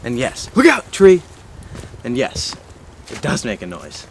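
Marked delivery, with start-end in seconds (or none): 0.6-1.2 shouted warning. Real, not acted. Warning shout during walk.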